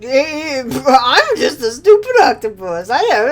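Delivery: slow, laughing fake-sobbing octopus; mock sob. Player voicing the beaten octopus, fake sobbing. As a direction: whimper it like a pathetic octopus.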